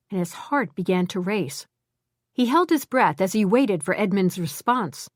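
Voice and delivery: usual speaking voice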